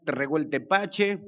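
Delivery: ordinary talk, nothing marked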